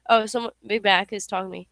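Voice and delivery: usual speaking voice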